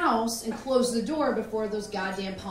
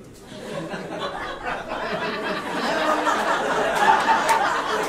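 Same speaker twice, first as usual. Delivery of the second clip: long breathy burst of laughter; just crack up; 7 semitones higher, 8 dB louder than usual. Real, not acted. Comic laughing at mom's mangled saying, long laugh.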